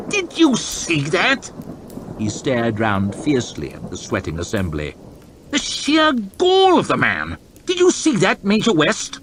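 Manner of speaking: high, outraged lordly rant; indignant fuming. Acted. Lord chamberlain fuming to West about the peasant's gall. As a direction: fume about the insult. Hoff fumes after the peasant.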